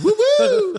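high-pitched woo-woo; very high, silly cheer; playful whoop. Pretend. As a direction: squeal a mock celebratory whoop. Jokey celebratory whoop.

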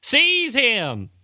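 high, loud, slow preacher call; fake deacon rallying. Fake deacon urging the faithful to seize the false prophet, high and loud. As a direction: rouse the congregation.

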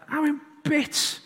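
real laugh, choked up and slow; laughing through tears. Real, not acted. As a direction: overcome with emotion while laughing. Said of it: "I burst into tears".

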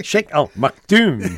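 mock Scottish, slow and loud; Scottish accent. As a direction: drawl Maktoum like a Scot. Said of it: "as if you're saying it in a Scottish accent".

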